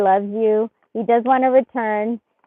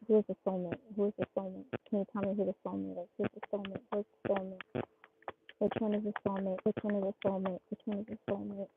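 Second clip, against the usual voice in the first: much softer, murmured asking; hushed repeated question; 3 semitones lower, 13 dB quieter, faster than usual. Real, not acted. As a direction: chant the question under your breath.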